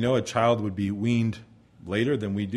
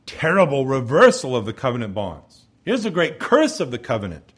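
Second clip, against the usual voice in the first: ordinary delivery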